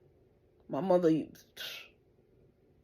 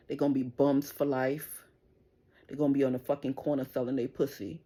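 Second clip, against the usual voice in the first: ordinary talk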